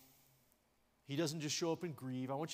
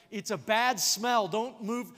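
loud mock protest; 6 semitones higher, 11 dB louder, slower than usual. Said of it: "they said".

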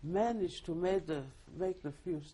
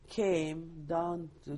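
ordinary delivery

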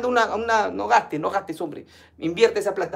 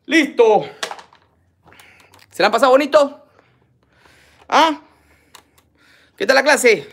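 loud, higher call to the audience; 5 semitones higher, 8 dB louder than usual. Real, not acted. Host checks in with viewers after the figures, calling out loudly. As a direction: project it like rousing a class.

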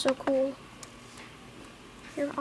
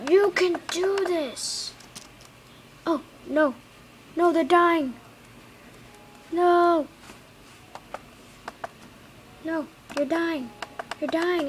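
higher, loud cries of no; desperate pleading; 6 semitones higher, 5 dB louder, slower than usual. Real, not acted. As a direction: beg them not to die. Pleading as mobs die.

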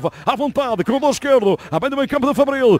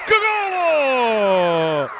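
fast dramatic football commentary; much slower than usual. Acted. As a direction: explode into the goal call. Goal call in live commentary.

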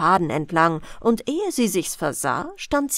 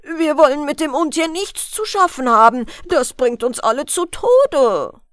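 louder, alarmed refusal; crying out; 4 semitones higher, 6 dB louder than usual. A narrator's reading. Villagers refuse to deal with the beast, crying out. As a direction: call it out as a frightened crowd. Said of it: "Da riefen sie".

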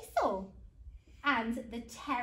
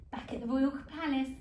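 ordinary delivery, nothing marked

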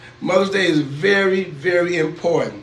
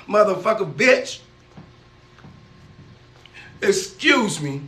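ordinary delivery

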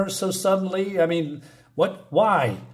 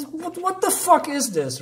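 incredulous outburst; 11 semitones higher than usual. Real, not acted. Outraged reaction to character list.

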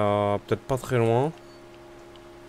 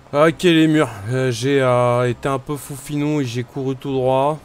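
startled; 3 semitones higher, 7 dB louder than usual. Real, not acted. Startled, running in a game.

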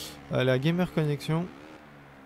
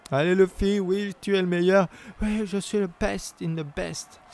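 mock bragging; 6 semitones higher, 3 dB louder than usual. Mock self-praise, then says pardon.

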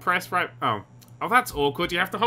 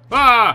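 short, loud, high "ah"; real yelp of surprise; startled yell; 6 semitones higher, 9 dB louder than usual. Real, not acted. Player startled after hurting an ankle, startled yell.

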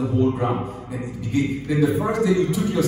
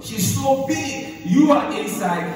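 drawn-out amazement; 9 semitones higher, 3 dB louder, slower than usual. Acted. Dramatizing pregnancy.

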